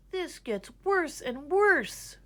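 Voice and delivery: high, slow moaning complaint; a touch of despair; mild whiny moan. A narrator's reading. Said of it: "moaned Gregory".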